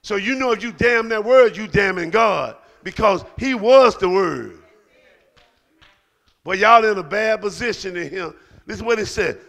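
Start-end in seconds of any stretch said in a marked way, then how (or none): 0.0-8.3 louder, forceful sermon build; preaching. Acted. Pastor warning the church about unbelief, forceful preaching. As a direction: preach it hard to the pews.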